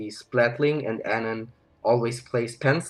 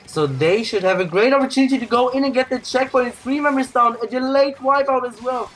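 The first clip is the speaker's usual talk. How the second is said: much higher-pitched esports call; excited play-by-play; 11 semitones higher, 5 dB louder than usual. Real, not acted. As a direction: call a team wipeout as it happens.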